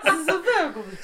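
tipsy mock Frenchman, giggling through it; mock French accent. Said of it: "my French guy's a Russian guy".

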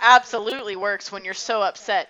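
loud, heavy sarcasm; sarcastic. Real, not acted. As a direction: drip with mock agreement. Mocking advice to calm down.

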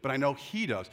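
ordinary talk

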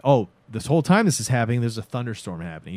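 usual speaking voice

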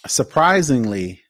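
ordinary talk

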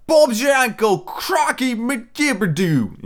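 hammed-up bad British accent, high and drawn out. Mocking the movie's British accent, exaggerated and high-pitched. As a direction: shout gibberish in a mock British accent.

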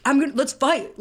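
fired-up challenge. Retold or imitated. Matt Dillon's character challenging his rival, mock fired-up. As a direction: play the hothead itching to fight.

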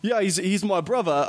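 lightly voiced Tom Hardy in Legend; mock British. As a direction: play a London hard man. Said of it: "That's, that's so British".

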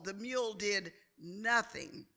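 ordinary talk, nothing marked

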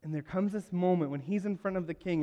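usual speaking voice

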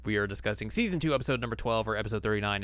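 normal talking voice

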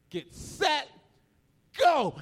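high, loud race start; suspenseful pause, then shouted GO; starter's call. Retold or imitated. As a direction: imitate a brother launching the race.

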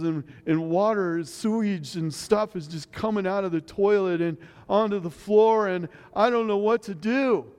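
a high, panicky rush, toned down; imitated panic. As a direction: mimic the daughter's flustered alarm. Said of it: "I'm putting it mildly compared to the way she said it".

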